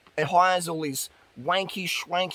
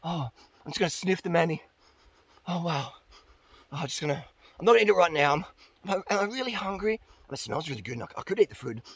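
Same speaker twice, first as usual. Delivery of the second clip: softer, breathy fruitarian sniffing the fruit; mock swooning fruitarian; 6 dB quieter, faster than usual. Fruitarian sniffing a fruit instead of eating, softer. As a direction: sigh over the fruit like a lost dreamer. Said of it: "They come here".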